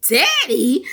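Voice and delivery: high, mock-incredulous query; incredulous question. Vlogger reacting to woman's pet name, mock disbelief.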